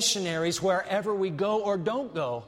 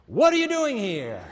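louder, higher, demanding question; God's loud challenge; 5 semitones higher, 4 dB louder than usual. Acted. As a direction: call out the question like a summons.

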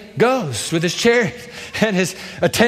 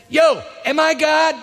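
distraught king; 10 semitones higher than usual. Acted. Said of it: "he tears his robes and he says".

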